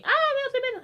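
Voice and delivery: high-pitched mock wail; imitated witch scream. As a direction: cry out like the Wicked Witch, in play. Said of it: "the Wicked Witch was me".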